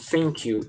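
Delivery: "thank you" pronunciado "fank you", lento; "thank you" com som de F. Shown as a demonstration. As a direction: troque o TH de "thank" por um F. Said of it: "Tem muita gente que fala assim, ó". (pronuncie "thank" com F, devagar)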